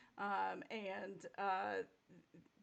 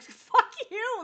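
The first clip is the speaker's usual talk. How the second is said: much higher and louder, a defiant yelled retort; mock defiant outburst; 15 dB louder than usual. Imitating herself telling off the casting directors, mock furious yell. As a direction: fire it back at the casting directors with outrage. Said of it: "And I'm like".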